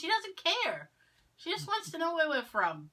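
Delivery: high-pitched exasperated protest; mock exasperation, voice up high. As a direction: act out fed-up correcting of a partner. Retells exasperated reply to partner.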